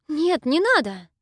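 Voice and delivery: loud, panicked refusal; frightened cry. Acted. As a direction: pull back in fear, loud. Heroine refuses the witch's drink. (испуганный крик)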